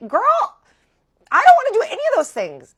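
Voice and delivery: very high, loud, fast shriek of protest; hammed-up high yell; outraged refusal. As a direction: act out being utterly appalled at a friend. Retold outraged refusal to friend.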